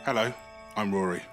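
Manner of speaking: ordinary delivery, nothing marked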